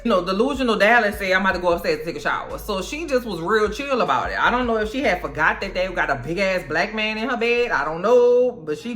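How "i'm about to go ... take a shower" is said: fast, tossed-off line; rushed. Retold or imitated. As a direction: rattle it off quickly. Quoting delusional Dallas.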